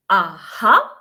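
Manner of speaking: AHA dipping, then swinging up; falling then rising. Shown as a demonstration. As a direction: say AHA with a drop and a rise at the end. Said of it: "should be fallen and then rising".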